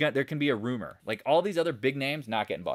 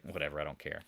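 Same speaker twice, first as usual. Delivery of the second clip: low, soft brush-off; retold indifference; 9 semitones lower, 9 dB quieter, faster than usual. Trader recalling his losing puts, retold indifference. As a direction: toss it off quietly, unbothered.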